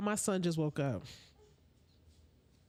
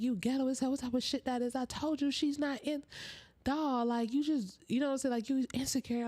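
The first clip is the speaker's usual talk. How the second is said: lightly voiced boyfriend cussing, higher; half-hearted imitation of him going off; mock angry cussing; 7 semitones higher than usual. Girl imitating boyfriend cussing out the girl, mock angry. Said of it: "he was low-key kinda cussing".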